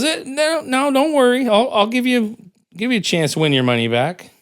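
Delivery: hammed-up crafty old man; squeaky old man. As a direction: play a sly high-pitched old geezer. Old guy voiced in joke.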